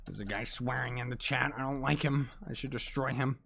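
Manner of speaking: ordinary talk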